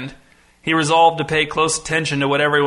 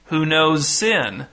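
slow question with "sin" raised, then falling; stress on SIN; much slower than usual. A narrator's reading. Pastor repeating his question from the pulpit, stressing "sin". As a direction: save the weight for "sin". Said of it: "rotating emphasis on each word".

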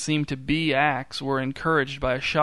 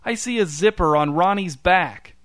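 higher, louder triumphant telling; insistent accusation; 4 semitones higher, 4 dB louder than usual. A narrator's reading. Newton insisting to Tammy that the gorilla is fake, accusing. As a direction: point it out like a gotcha. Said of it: "said Newton".

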